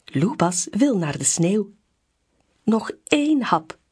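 ordinary delivery

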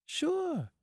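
very high, smiling woman's voice. A narrator's reading. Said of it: "smiled Marcia".

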